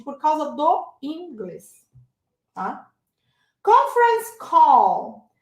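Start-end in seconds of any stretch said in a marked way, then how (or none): 3.6-5.3 "Conference call" said slowly and loudly; "Conference call", drawn out with a clear English vowel; model pronunciation. Shown as a demonstration. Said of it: "pode ser uma das dezessete palavras".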